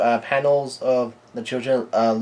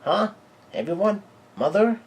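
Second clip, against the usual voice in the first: high, sharply rising questions; puzzled calling; 8 semitones higher than usual. Acted. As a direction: call out for people who have vanished. Lillian notices everyone is gone.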